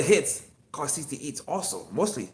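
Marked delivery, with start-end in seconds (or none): none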